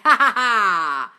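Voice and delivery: loud, drawn-out triumphant laugh; real gleeful cackle, loud and slow; delighted cackle. Real, not acted. Coach celebrating a follower's success, delighted cackle.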